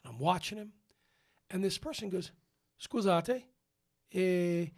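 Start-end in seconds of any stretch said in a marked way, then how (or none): 2.8-4.7 Italian. Retold or imitated. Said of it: "he says to me in Italian".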